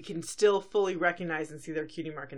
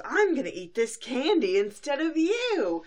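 big Nightmare Moon voice; 7 semitones higher, 6 dB louder than usual. Retold or imitated. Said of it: "big Nightmare Moon voice".

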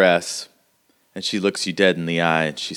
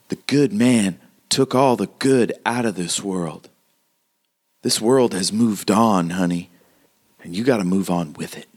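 grave frontier woman, measured and emphatic; hardened townswoman, solemn; 3 semitones higher than usual. Acted. Townswoman explaining the law is gone, solemn. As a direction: pronounce it like a hard-bitten local.

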